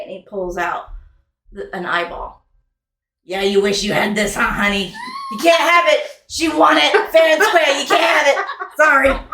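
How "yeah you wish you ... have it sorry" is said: laughing, getting louder toward the end; teasing taunt. Acted. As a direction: gloat playfully over the prize. Jack teases the player.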